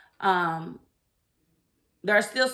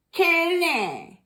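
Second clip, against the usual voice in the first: sing-song name; 8 semitones higher than usual. Shown as a demonstration. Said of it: "I don't know why I'm like that".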